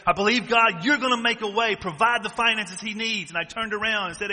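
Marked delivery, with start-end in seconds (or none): 0.1-3.3 praying harder and harder for provision; fervent prayer. Retold or imitated. Praying for a brother, rising to a loud fervent cry.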